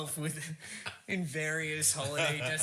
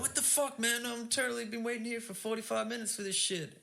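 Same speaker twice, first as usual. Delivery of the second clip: angry complaining; 7 semitones higher than usual. Pretend. Acted angry arguing friend.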